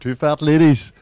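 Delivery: bingo caller. Pretend. Joins in with bingo calls.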